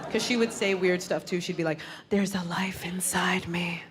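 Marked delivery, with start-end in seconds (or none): 2.1-3.8 hushed, slow whisper. Retold or imitated. Pregnant friend who whispers everything, solemn whisper. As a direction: breathe it reverently, slowly. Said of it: "she started whispering everything".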